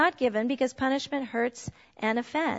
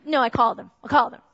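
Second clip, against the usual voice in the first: ordinary talk, nothing marked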